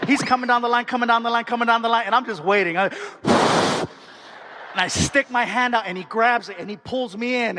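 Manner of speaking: ordinary talk, nothing marked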